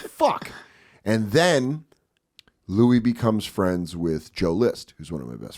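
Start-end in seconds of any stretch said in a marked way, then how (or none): 0.1-0.5 high-pitched acted curse; mock exasperation. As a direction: let the curse jump up high. Acted reaction of envious reader.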